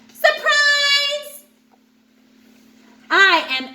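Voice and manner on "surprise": much higher and louder, popping out; spring out and shout it; gleeful surprise. Acted. Angel shark bursting from the sand to surprise the others, gleeful.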